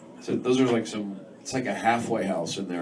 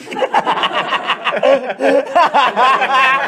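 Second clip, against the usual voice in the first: loud laugh; 13 semitones higher, 9 dB louder than usual. Real, not acted. Big laugh at own joke.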